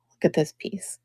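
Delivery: hushed admiring whisper. Real, not acted. Admiring a favourite brooch to viewers, whispered. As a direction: breathe it in awe.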